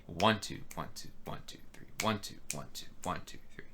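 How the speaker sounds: each ONE voiced, the rest of the count whispered; counting with accents. Shown as a demonstration. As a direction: lean on every ONE, breathe the other numbers. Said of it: "emphasizing the right notes".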